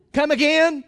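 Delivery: disbelieving. Acted. Committee disbelief at the news.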